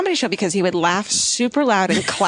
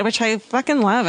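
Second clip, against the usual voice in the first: ordinary delivery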